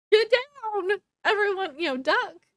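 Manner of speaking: high, loud retold shout; calling out a mock alarm; urgent warning. Aurora warning everyone before lightning shoots out, urgent shout.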